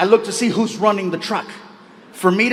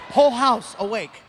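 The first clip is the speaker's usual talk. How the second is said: usual speaking voice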